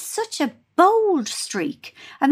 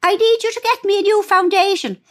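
mum's voice; 9 semitones higher, 4 dB louder than usual. Retold or imitated. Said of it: "she said".

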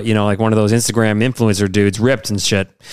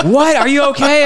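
high, louder, startled wife with a laugh; alarmed wife; 14 semitones higher, 4 dB louder than usual. Retold or imitated. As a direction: mimic the wife's shocked worry. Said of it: "Meg's like".

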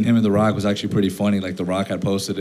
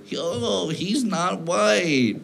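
1 mocking butthurt fans; 7 semitones higher, 5 dB quieter, much slower than usual. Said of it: "all the butthurt people".

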